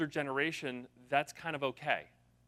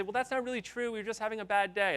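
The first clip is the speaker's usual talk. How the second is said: high, whiny mock excuse; defensive reply; 8 semitones higher than usual. Company replies to bad reviews, high defensive mock whine. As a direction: play a company brushing off reviews. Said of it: "You wanna reply and say".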